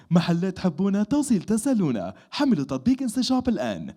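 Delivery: fast, high commercial read in Arabic; إعلان تجاري سريع ومرتفع. Shown as a demonstration. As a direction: rattle off the Arabic ad line. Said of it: "I've done a lot of the".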